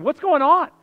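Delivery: high, loud puzzled call into the dark; alarmed neighbors. Retold or imitated. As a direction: mimic a worried parent calling out. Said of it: "families coming out of their houses wondering".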